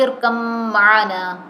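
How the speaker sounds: ارکب معنا with the ب swallowed into a doubled nasal م; ba merged into meem. Shown as a demonstration. Teacher showing students the merged ارکب معنا. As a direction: hum straight through the doubled م of ارکب معنا. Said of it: "با کی sound نہیں آئے گی".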